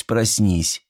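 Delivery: ordinary delivery, nothing marked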